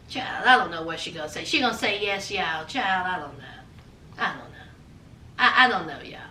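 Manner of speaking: low, soft, fast hushed suspense; a nervous hushed aside. Real, not acted. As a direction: lean in and hush it like a secret. Nervous suspense about her answer.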